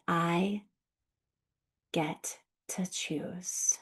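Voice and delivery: slow, soft spoken affirmation; gentle slow voiced affirmation. Shown as a demonstration. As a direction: say it slowly and softly to yourself. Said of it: "you may get to say".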